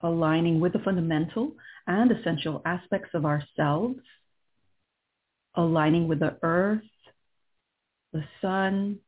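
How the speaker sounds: calm and unhurried, guiding inward; right in your ear. Acted. Leads a guided meditation.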